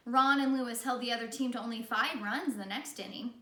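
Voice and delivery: plain reading voice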